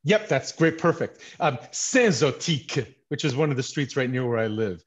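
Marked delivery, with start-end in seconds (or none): 1.7-2.9 Saint Zotique slow, voiced like an oath; swear-style Saint Zotique. Shown as a demonstration. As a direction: make Saint Zotique a curse, slowly. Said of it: "gotta say it with the real thing".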